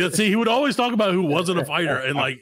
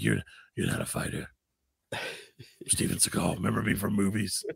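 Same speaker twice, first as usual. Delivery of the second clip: Steven Seagal impression; 7 semitones lower, 6 dB quieter than usual. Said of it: "he'd be like".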